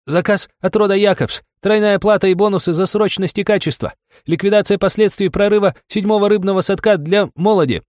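gasping from running; out of breath. Acted. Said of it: "Задыхающиеся слова паренька".

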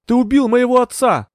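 very high, loud accusing cry; high-pitched shout of outrage; screamed accusation. A narrator's reading. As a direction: hurl it as a furious scream. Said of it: "закричала она прямо в лицо Энтони".

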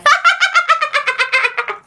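high eerie cackle; evil ghost laughter. Acted. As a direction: cackle like a triumphant spirit. (tiếng cười ma quái the thé)

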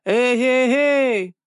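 high, drawn-out hailing call; hallooing. A narrator's reading. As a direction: holler through cupped hands. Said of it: "приложив ладони рупором к рту".

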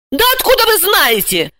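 high-pitched, exasperated challenge; angry bark. A narrator's reading. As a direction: snap back at the caller. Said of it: "рявкнула Ася". (рявкает сердито)

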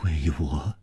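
low, soft questioning echo; hushed disbelief. A narrator's reading. Lover told the revenge is for them, hushed. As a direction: echo it softly, taken aback. Puzzled echo after vow.

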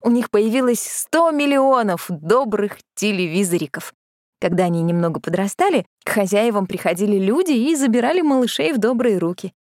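usual speaking voice

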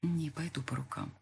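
soft broken refusal; quiet murmur. A narrator's reading. Heroine murmurs her refusal to be passed around, soft. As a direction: barely voice it. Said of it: "прошептала тихо".

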